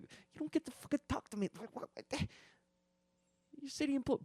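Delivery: high, hushed sputtering outrage; mock-mad stammering under the breath; retold anger. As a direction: sputter indignantly in a small, high voice. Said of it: "I got pretty mad".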